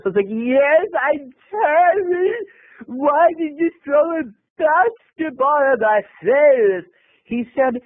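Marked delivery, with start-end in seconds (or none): 0.3-6.9 slow, high mock sobbing; Marsha Brady. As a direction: wail it as if hit on the nose. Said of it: "like Marsha Brady when the football".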